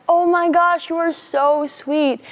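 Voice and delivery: high, loud gushing delight; gushing Carol. Retold or imitated. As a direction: imitate a woman overjoyed at a kind stranger. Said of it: "She was like".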